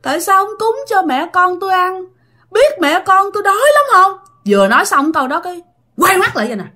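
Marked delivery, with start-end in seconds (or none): 0.0-4.1 high, moaning lament; high, mournful ghostly wail; wailing ghost plea. Retold or imitated. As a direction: moan it like a hungry ghost begging. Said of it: "đang khóc".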